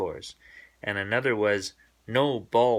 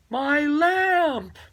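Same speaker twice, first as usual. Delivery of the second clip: high wailing dismay; dismayed Mama; 5 dB louder than usual. Acted. Mama finding her lamp broken, high dismayed wail. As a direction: cry out over the broken lamp.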